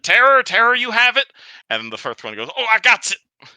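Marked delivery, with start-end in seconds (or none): 0.0-1.3 very high, loud goblin call. Acted. Goblin calls to friend. 2.6-3.5 very high, louder goblin. Acted. Goblin answers.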